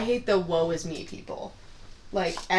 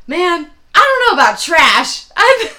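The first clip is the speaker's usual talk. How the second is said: hammed-up loud barfly; mock George; 5 semitones higher, 10 dB louder than usual. George impression to bartender.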